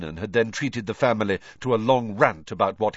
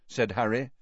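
ordinary delivery, nothing marked

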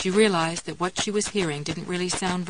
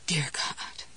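dry, grating whisper; 4 semitones lower, 7 dB quieter than usual. A narrator's reading. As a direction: rasp it like dust in the cracks. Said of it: "her grating dust-in-the-cracks voice".

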